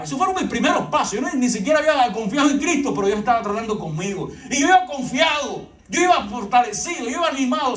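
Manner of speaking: high-pitched, driving sermon delivery; urgent exhortation to the congregation; impassioned preaching. Acted. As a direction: preach it with rising fervour, pitched well up. Preaches at full force.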